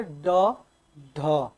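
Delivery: unhurried ड and ढ, tongue easily touching the palate; drawn-out, easy ड and ढ; relaxed retroflex stops. Shown as a demonstration. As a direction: say ड, ढ as plain stops without flicking. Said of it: "आराम से".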